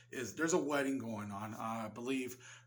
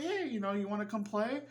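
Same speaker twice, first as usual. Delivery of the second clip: put-on much higher, friendly little girl; hammed-up innocent little girl; 7 semitones higher than usual. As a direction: chirp the invitation like a small child. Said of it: "She's like".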